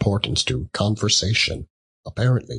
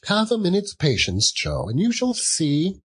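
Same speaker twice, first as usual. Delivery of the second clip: high teasing woman; 11 semitones higher than usual. A narrator's reading. Woman teasing old Joe.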